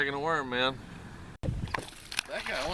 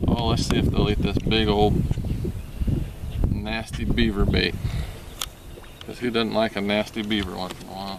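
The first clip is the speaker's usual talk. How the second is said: loud joking; 7 dB louder than usual. Acted. Joking loudly about the bait.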